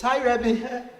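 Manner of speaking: a hint of a kid's bright, laughing hello; cheery student greeting. Returning student greeting his rebbe off the bus, lightly mimicked cheer. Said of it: "and he says".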